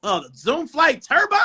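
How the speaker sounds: high, excited recognition rising into a question; real thrilled surprise; delighted surprise. Real, not acted. Shown a shoe he remembers.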